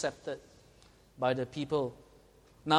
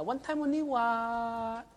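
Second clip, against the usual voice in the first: slow Singlish mock, pitched up; 5 semitones higher, slower than usual. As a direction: drawl the excuse mockingly. Mocking the excuse again.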